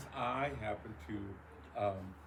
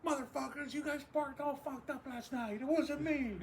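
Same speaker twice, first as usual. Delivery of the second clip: much higher, slow whine; whiny kid protest. Retold or imitated. Much higher whining denial to the other parkers. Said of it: "I was like".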